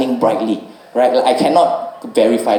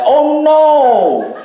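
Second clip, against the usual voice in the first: very high, louder cry of dismay; alarmed driver; 5 dB louder than usual. Retold or imitated. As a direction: squeal "Oh no!" high and held. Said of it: "And then he was like".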